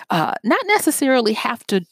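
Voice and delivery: ordinary talk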